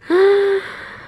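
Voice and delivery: sharp excited gasp. Real, not acted. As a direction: gasp in real delight. Another view reaction.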